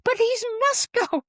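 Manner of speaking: very high acted-out insistence; insistent excited yell. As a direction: re-enact yelling it, refusing to be corrected. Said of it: "I just let out a yell".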